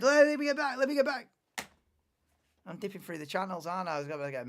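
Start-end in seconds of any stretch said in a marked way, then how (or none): 0.0-1.3 high and loud, eager repeat; excited. Real, not acted. Streamer eager to get back to the race, high loud excitement. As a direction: bounce it, itching to get back.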